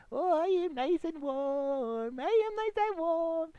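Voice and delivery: slow, drawn-out contented purr; blissful sigh of warmth. Retold or imitated. Bob imagining himself cosy in the warm. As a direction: savour the warmth, slowly. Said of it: "I can go".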